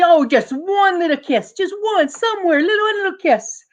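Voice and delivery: put-on high, wheedling old lady voice; pleading granny. Grandma begging for one little kiss.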